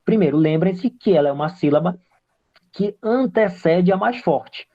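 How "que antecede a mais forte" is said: ordinary talk, nothing marked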